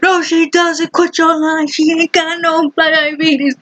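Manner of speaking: high, hammed-up yelling dad, slowing at the end; imitating dad shouting up the stairs; mock shout back. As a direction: belt it like a stubborn father. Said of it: "he shouts back".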